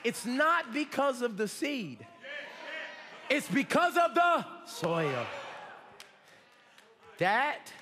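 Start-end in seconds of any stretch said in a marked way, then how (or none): 0.0-5.3 impassioned, rising revival preacher; full-force preaching. Real, not acted. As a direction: drive each phrase home to rouse the congregation.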